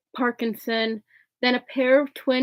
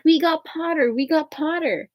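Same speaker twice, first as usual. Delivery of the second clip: twins yelling; 6 semitones higher than usual. A narrator's reading. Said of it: "the Weasley twins yelled".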